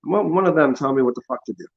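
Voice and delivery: normal talking voice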